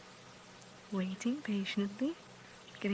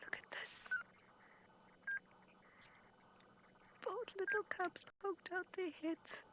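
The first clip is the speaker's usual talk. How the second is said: hushed, thrilled whisper; soft, breath-held wonder; excited whisper; 11 semitones higher, 11 dB quieter than usual. Real, not acted. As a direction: whisper so the cubs aren't scared. Hushed watching of shy cubs.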